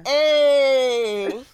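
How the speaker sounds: triumphant cheer. Real, not acted. Co-host learns she guessed the accent right, triumphant cheer. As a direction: crow it loudly, holding the vowel. Gloats at being proved right.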